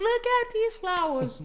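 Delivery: high, squealing best friend; excited squeal. Retold or imitated. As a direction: shriek it with delight at the roses. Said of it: "I'm like".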